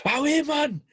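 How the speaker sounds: high-pitched Geordie call across the pitch; Henderson Geordie accent. Retold or imitated. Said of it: "in his massive Geordie accent".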